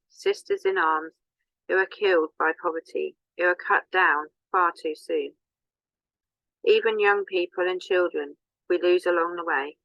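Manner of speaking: normal talking voice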